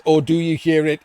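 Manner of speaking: usual speaking voice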